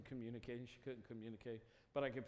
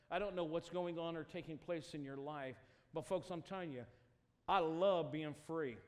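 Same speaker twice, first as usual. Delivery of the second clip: loud, high, impassioned preaching; preaching at full force; 5 semitones higher, 9 dB louder than usual. Acted. Preacher urging the congregation toward freedom in Jesus. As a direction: lift it to a rousing altar call.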